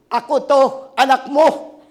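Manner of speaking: loud cry of recognition; shouting out. Retold or imitated. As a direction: call out to mother from the bank. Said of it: "doon ako napasigaw". (malakas na sigaw sa nanay)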